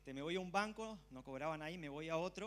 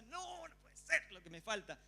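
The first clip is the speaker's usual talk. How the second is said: exasperated complaint; 6 semitones higher than usual. Retold or imitated. Retold frustration at bank queue.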